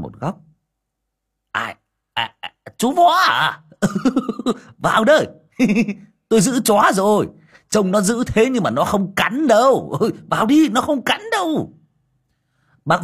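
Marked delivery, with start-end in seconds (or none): 1.5-11.7 high, loud voice broken by laughs; laughing welcome. A narrator's reading. Đồng laughs, reassuring Vó that the dog won't bite. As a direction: chuckle him in past the dog.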